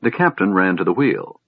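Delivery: ordinary delivery, nothing marked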